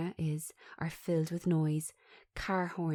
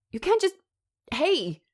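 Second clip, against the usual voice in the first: high, loud indignant outburst; startled yell of protest; shocked protest; 10 semitones higher, 7 dB louder than usual. Acted. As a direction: cry out as they barge past. Jack protests the intrusion.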